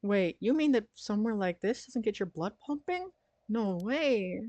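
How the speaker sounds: high excited airhead; giddy ditzy sidekick voice; bimbo voice. Acted. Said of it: "a bimbo voice for Ario".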